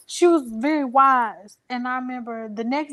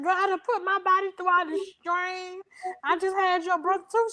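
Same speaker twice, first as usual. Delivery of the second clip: much higher, bossy and scolding; sassy mama; 8 semitones higher than usual. Retold or imitated. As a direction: mom ordering her daughter out. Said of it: "she said".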